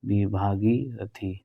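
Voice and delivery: भागीरथी stretched slowly; slow answer. Shown as a demonstration. Teacher confirming option B, saying भागीरथी slowly. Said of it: "भागीरथी होता है".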